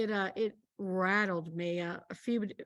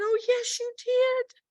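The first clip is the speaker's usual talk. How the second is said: joyful Lord; 10 semitones higher, 5 dB louder than usual. Retold or imitated. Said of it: "And the Lord said".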